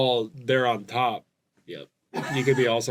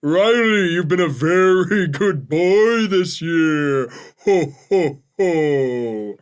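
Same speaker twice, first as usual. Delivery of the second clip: slow, louder ghostly Santa; 3 semitones higher, 4 dB louder, slower than usual. Acted. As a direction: Santa voiced as a spirit. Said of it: "Santa always kinda sound like a ghost".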